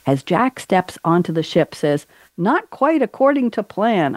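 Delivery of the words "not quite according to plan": higher-pitched, half-hearted pirate line; attempted pirate quote. Host reading a film quote to guest, lightly voiced pirate. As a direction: read a pirate's line without much accent. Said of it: "I can't do a pirate accent".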